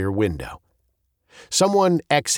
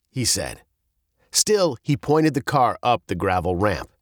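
ordinary delivery, nothing marked

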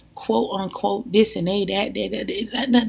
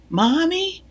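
put-on higher, rising little-girl call of Mommy; child calling; 9 semitones higher than usual. Little girl in the store calling for her mother, high and rising. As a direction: call out like a lost small child.

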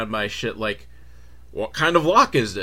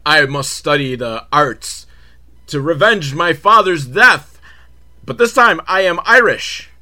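hammed-up fake Irish brogue, louder; mock-heroic vow in a bad Irish accent; 4 semitones higher, 8 dB louder than usual. Podcaster voicing the Irish fighter's vow, bad Irish accent. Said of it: "a bad Irish accent".

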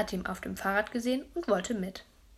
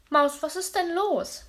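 put-on higher, anxious question to the child; concerned parent, pitched up and louder; worried father; 9 semitones higher, 5 dB louder, slower than usual. A narrator's reading. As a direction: ask the sobbing boy what's wrong. Father asks crying child.

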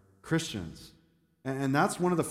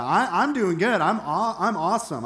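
mock self-congratulation, pitched up and louder; self-satisfied boast; 5 semitones higher, 5 dB louder than usual. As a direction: brag as a smug churchgoer.

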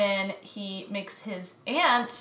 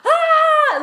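high loud squeal of excitement; excited shriek; 12 dB louder than usual. Retold or imitated. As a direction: shriek with delight. Excited shriek reading the book.

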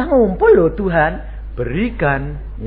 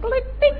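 imitated click sound. Preacher mimics a sound effect.

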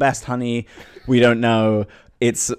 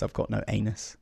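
softly confessed, much quieter; quiet admission; 9 dB quieter than usual. Acted. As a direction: admit it under your voice. First admission, later repeated as shout.

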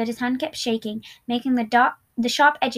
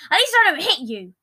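louder, higher retort; yelling back; 6 semitones higher, 5 dB louder than usual. A narrator's reading. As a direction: yell it back in defence. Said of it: "Deceit yelled back".

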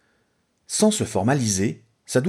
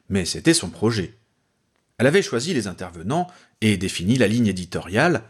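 ordinary delivery, nothing marked

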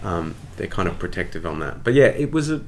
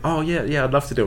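higher-pitched mock keenness; retold eager yes, pitched up; eager acceptance; 7 semitones higher, faster than usual. Actor calls Wayne back about the play, eager.

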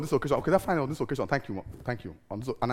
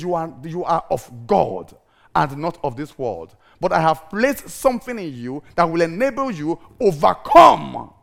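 voice of God; 6 semitones lower, slower than usual. Acted. God's words quoted slowly.

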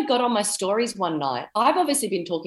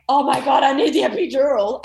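retold cry for the epidural, higher and louder; a mock labour plea at the peak of pain; 7 semitones higher, 5 dB louder than usual. Mother in labour begging for the epidural, in a half-acted loud cry. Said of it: "that's when you always cry out for it".

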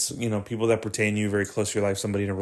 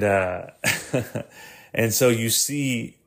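ordinary talk, nothing marked

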